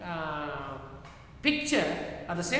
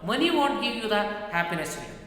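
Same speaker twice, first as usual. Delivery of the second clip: ordinary delivery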